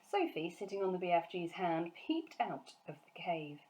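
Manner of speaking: usual speaking voice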